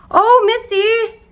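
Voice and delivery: loud, high drawn-out cry of the pet's name; calling out to pet. Real, not acted. Owner suddenly calling out to her pet Misty, loud startled cry.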